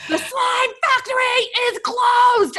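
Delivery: mother shouting a decree at her slime-obsessed daughter; mock shout. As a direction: bellow the verdict like a fed-up mom. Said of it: "And I was like".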